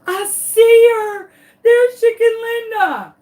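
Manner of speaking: high, loud, slow calling out; excited call-out. Retold or imitated. An admin spotting the chicken, calling out high and loud. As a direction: call it out across the yard.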